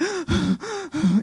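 mock gasp for breath, high; retelling the climb with a short breathless gasp; winded gasp. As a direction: imitate a sharp, exhausted gasp. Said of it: "I'm huffing and puffing".

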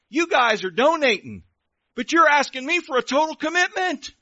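loud, high-pitched indignant pig; protesting pig. Acted. Pig objecting to cow and chicken's request, loudly protesting.

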